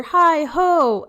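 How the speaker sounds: loud cheery call. Acted. Hare calls at the door.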